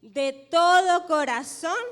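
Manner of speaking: preacher booming the key phrase so the congregation echoes it; loud, slow repeat. Shown as a demonstration. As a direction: call it out with full force for the church to repeat. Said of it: "Yo quiero que repitan".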